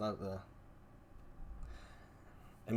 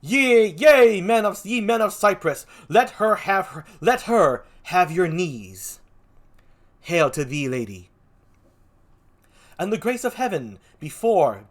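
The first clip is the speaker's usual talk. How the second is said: grand, drawn-out stage hail; theatrical proclamation; 4 semitones higher, 6 dB louder, slower than usual. Acted. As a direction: hail her like a herald before a crowd. Reads a play in character.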